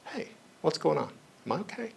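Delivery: very soft, caring self-talk; gentle self-check. Shown as a demonstration. Talking to yourself when upset, gentle concerned asking. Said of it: "Next time you're really upset with yourself".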